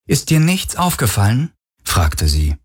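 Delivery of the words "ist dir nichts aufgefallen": Kirai's voice, pitched well up, prodding; pointed higher question. Acted. Kirai prodding Galen about dinner, higher pointed question. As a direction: make him think.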